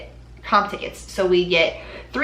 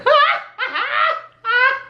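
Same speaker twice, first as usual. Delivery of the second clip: high-pitched burst of laughter; 4 dB louder than usual. Real, not acted. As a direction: laugh out of the fake sob. Laughs after fake sob.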